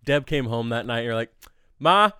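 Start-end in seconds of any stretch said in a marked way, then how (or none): 1.8-2.1 high, loud mock announcement to Mom; excited announcement. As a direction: break the big news, hammed up and laughing. Mimics friend excitedly telling mom a story.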